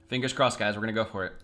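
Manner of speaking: usual speaking voice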